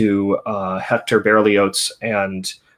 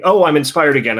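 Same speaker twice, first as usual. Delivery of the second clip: bright, louder, higher burst of fake inspiration; student to teacher, a hammed-up eureka, higher and louder; mock inspired exclamation; 4 semitones higher, 6 dB louder, faster than usual. Playfully overdone excitement. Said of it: "without just waiting".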